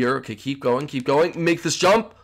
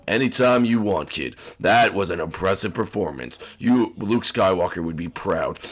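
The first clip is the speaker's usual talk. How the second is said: deep, put-on captain voice; Han Solo; 10 semitones lower, 4 dB quieter than usual. Rescued pilot praising the kid's performance, low hero voice. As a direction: give praise in a very low, softer voice. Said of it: "Really, Han Solo?".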